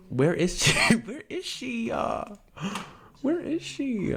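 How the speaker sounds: high, singsong searching with a laugh; slow, high-pitched playful calling. Pretend. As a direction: coo it high as you hunt around. Hunting for the nominations page.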